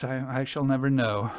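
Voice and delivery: ordinary delivery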